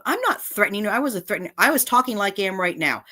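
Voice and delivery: usual speaking voice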